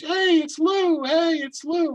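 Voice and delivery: announcer call. Retold or imitated. Announcer calling out intro.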